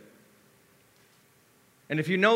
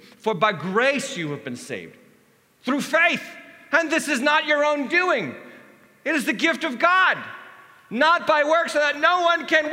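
higher, louder proclamation; the preacher reads Ephesians with rising force; scripture read aloud; 10 semitones higher, 7 dB louder than usual. Acted. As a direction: proclaim the verse.

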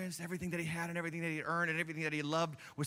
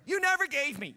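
aggrieved complaint; 9 semitones higher, 5 dB louder than usual. Acted. Mocking the son's complaint.

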